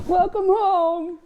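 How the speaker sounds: teasing high welcome; mock welcome. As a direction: drawl it out in a high teasing lilt. Mock greeting to grandparents.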